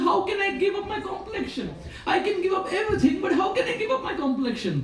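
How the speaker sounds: Krishna refusing to give up his complexion, high laughing shout; Krishna protesting with a laugh. Acted. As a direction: cry out half-laughing at the impossible demand. Krishna protesting impossible demand.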